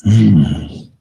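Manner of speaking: usual speaking voice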